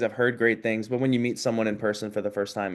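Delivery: ordinary talk, nothing marked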